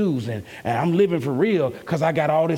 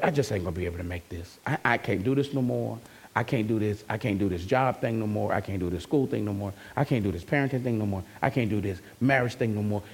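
low, soft, worn-out voice; voicing someone at the end of their rope; weary despair; 6 semitones lower, 5 dB quieter, faster than usual. Preacher voicing a worn-out believer, low and soft. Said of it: "when you feel like".

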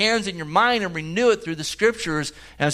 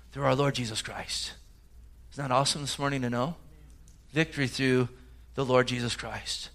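hushed awe; 5 semitones lower, 10 dB quieter than usual. Real, not acted. Preacher drops to hushed awe.

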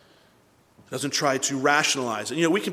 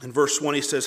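normal talking voice

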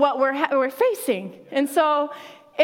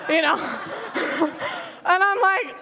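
laughing; 3 semitones higher than usual. Real, not acted. Laughing at own story.

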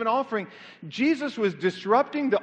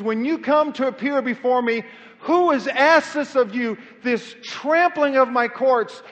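stern rebuke; 4 semitones higher, 6 dB louder than usual. Acted. The Lord to Israel through Isaiah, loudly rebuking. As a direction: hammer out the accusation, drawing out the key words.

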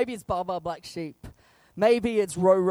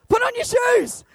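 a high, louder order; exasperated dad; 13 semitones higher, 7 dB louder than usual. Retold or imitated. Dad telling kid to put shoes on, high and louder. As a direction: order it for the hundredth time. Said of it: "It doesn't change".

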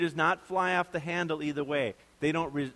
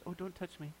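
timid whimper; 2 semitones higher, 13 dB quieter than usual. Pretend. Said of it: "stands in the corner and says".

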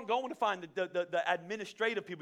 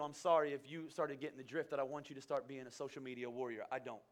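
normal talking voice

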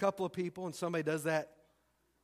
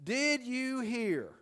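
slow, higher, drawn-out "Did you hear?"; teasing gossip hook; gossip's opener; 6 semitones higher, much slower than usual. Shown as a demonstration. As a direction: draw out "Did you hear?" like juicy news is coming. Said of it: "here are a few to get you started".

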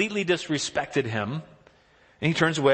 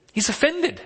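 "offended" jumps high and lands with a fall; stress on offended; 13 semitones higher than usual. Shown as a demonstration. Preacher telling the church David is offended, "offended" sung out high. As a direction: lift the voice sharply on "offended". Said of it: "preacher stresses offended".

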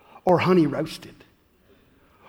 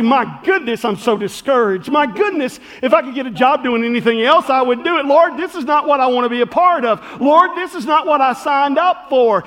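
discouraged lament; 6 semitones higher, 6 dB louder than usual. Retold or imitated. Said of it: "and I would say".

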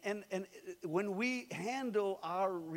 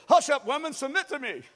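hammed-up loud, higher command with a laugh; mock bossy husband; 7 semitones higher, 11 dB louder than usual. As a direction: play the macho husband, half joking, loud. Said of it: "So you thought you'd say".

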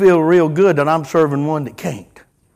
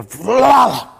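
mock crash sound; 3 semitones higher than usual. Retelling a sudden noise.

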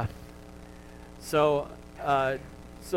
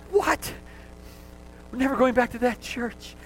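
mock shocked visitor; 8 semitones higher than usual. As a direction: squeal it like a horrified first-timer. Mock horrified churchgoer.

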